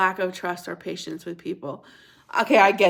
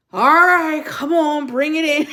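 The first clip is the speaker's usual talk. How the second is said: loud, put-upon giving in; exaggerated reluctant "come here," pitched up; grudging hug offer; 7 semitones higher, 8 dB louder than usual. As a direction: mimic yourself reluctantly allowing a hug. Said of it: "I made it like".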